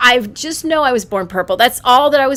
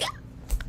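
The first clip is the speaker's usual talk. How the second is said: mock baby shriek; 14 dB quieter than usual. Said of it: "I was like".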